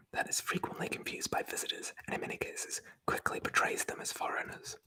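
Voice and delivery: normal talking voice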